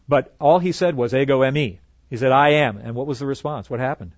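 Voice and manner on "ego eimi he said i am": "Ego eimi" then "I am" both louder and firm; emphatic Ego eimi. Shown as a demonstration. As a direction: proclaim the words plainly and strong. Giving Jesus's exact words.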